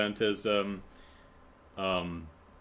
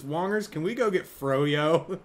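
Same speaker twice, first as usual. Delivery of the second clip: eager plea; 8 semitones higher, 4 dB louder than usual. Retold or imitated. Madison pleading with Wong.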